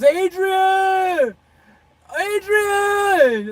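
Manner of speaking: high, loud, drawn-out cry of a name; Rocky's mountain shout. Retold or imitated. Retelling the Rocky movie scene, high loud calling shout. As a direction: bellow it like a boxer on a mountaintop. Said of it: "and he goes".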